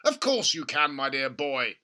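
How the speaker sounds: loud, eager cry; excited cry. A narrator's reading. Father urging George, crying out. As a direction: cry out encouragingly. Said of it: "cried Mister Cranky".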